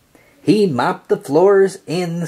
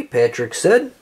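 plain reading voice

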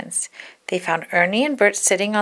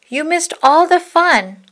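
high, louder teasing; higher, louder teasing sister; 9 semitones higher, 6 dB louder than usual. Acted. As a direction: tease high and loud.

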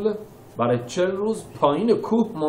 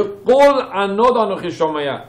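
higher, louder pained quote; Moses's anguished, louder quote; anguished cry; 7 semitones higher, 6 dB louder than usual. Acted. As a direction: voice the sound of suffering. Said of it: "یعنی از رنج میاد".

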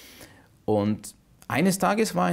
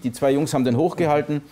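ordinary talk, nothing marked

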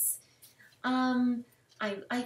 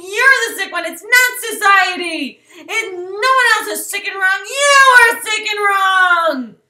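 much louder, higher, sobbing accusation; hammed-up tearful yell; 7 semitones higher, 13 dB louder than usual. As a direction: scream it at her through fake tears. Diary outburst aimed at Mary.

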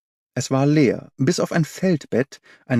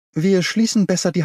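calling out; 7 semitones higher than usual. A narrator's reading. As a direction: call it out quickly to companions, pitched up. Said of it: "rief Holmes".

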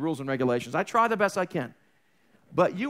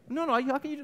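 higher, urgent disbelief; pleading protest; 8 semitones higher, much faster than usual. Retold or imitated. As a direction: protest the widow's offer. Woman refusing the sacrifice.

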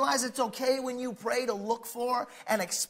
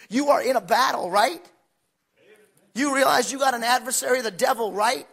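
ordinary talk

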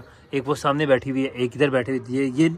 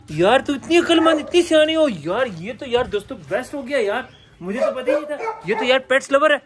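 delighted praise; 8 semitones higher than usual. Real, not acted. Delighted praising the clever pet.

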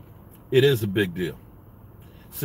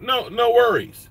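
sarcastic no worries; 8 semitones higher, 3 dB louder than usual. Pretend. Sarcastic mock reassurance.

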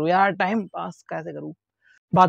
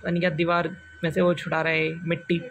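usual speaking voice

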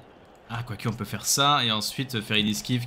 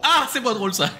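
high, loud wry groan; 12 semitones higher, 4 dB louder than usual. Real, not acted. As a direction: wince up high.

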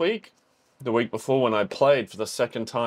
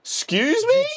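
much higher, sharply offended; indignant; 4 dB louder than usual. Real, not acted. Fan outraged the crowd boos, indignant cry. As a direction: act personally insulted.

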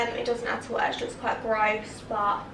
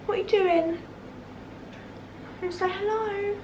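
higher, sing-song call to the pet; coaxing pet voice; 7 semitones higher, 5 dB quieter than usual. Real, not acted. Talking sweetly to her pet to come over. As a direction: coax the little one over gently.

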